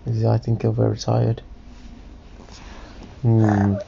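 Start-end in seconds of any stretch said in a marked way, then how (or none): none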